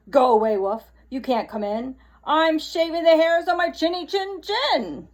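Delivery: loud pig's retort; yelling back. Acted. Pig yelling at the wolf, a mild mock yell. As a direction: shout it back through the door. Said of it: "He yelled back".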